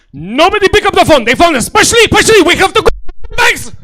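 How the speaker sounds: high, loud frantic alarm; a hammed-up father in full panic; panicked dad. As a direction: shout the family into hiding. Dad panicking, family fleeing.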